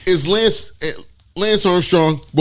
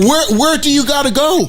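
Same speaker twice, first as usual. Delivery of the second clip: mocking incredulity; 6 semitones higher than usual. Mocking reckless driver, laughing.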